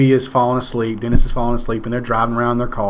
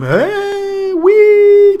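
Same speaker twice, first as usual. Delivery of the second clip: much higher, louder gleeful wee; falling kid cheering; 6 dB louder than usual. Retold or imitated. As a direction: cheer like a kid enjoying the fall. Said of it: "hear it change from".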